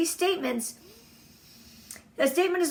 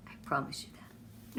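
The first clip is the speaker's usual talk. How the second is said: soft, lower, nearly whispered; whispered promise; 8 semitones lower, 12 dB quieter than usual. Real, not acted. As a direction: lean in and promise quietly. Intimate promise to audience.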